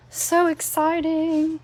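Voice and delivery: high, loud, drawn-out squeal of delight; thrilled. Real, not acted. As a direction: gush over it slowly and brightly. Thrilled by pearly ink effect.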